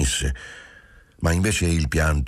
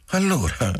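stifled laugh; 10 semitones higher than usual. Acted. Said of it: "soffocando una risata incredula".

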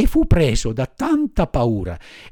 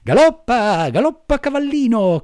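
high, gleeful urging; cheerful shout to gallop; happy cry; 9 semitones higher, 3 dB louder than usual. Acted. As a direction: spur the pigeon on with joy. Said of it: "gridò tutto contento".

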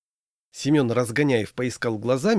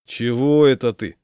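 louder question with disapproval; disapproving; 7 dB louder than usual. A narrator's reading. Friend questioning the floor-washing groom, disapproving. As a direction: ask it with clear disapproval. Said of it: "неодобрительно поинтересовался Семён".

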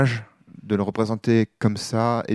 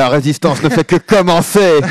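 mock rebel battle cry; 7 semitones higher, 9 dB louder than usual.

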